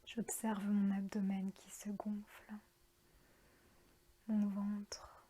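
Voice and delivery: half-whispered calm, drawing out each phrase; guided meditation. Acted. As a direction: guide them gently into their breathing, unhurried. Leads a guided breathing meditation.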